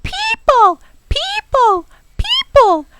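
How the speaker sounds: very high, loud alarm screeches; screaming tree squeaks. A narrator's reading. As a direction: screech it over and over as a warning. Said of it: "they were screaming".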